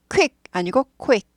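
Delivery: slow whispered demo of "quick", the q barely voiced; 속삭이며 quick 시범; whispered quick contrast. Shown as a demonstration. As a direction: whisper "quick" twice, the second with a short kw glide. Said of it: "q 를 되게 짧게 지나요".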